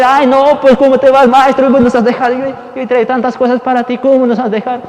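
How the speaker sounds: loud, high-pitched pleading; acted-out upset, high and insistent; distressed apostles' protest. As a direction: plead in dismay with a master who is leaving. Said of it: "Se ponen tristes".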